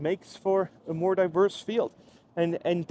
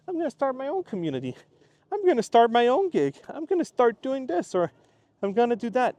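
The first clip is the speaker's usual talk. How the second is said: higher, brisk and eager; eager imagined creator; 8 semitones higher, 4 dB louder than usual. Pretend. New creator announcing plans, higher and eager. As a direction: voice someone fired up to start.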